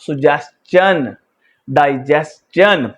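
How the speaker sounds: सजेशन and डाइजेशन drawn out slowly, ending on a clear chan; teacher carefully modelling the चन sound; slow pronunciation demo. Shown as a demonstration. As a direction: spell out each word slowly for the class. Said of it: "मिसाल के तौर पर देखिएगा".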